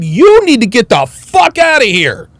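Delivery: mock-retold furious ejection; retold shout. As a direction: bark the order to leave. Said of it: "I said".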